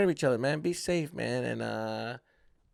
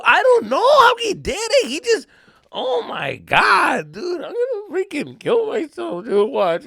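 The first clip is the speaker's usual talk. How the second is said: high, loud wailing excuses; hammed-up tearful tantrum; mock crybaby; 11 semitones higher, 6 dB louder than usual. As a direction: wail and shift the blame, pitched way up. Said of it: "I was freaking Mister Crybaby".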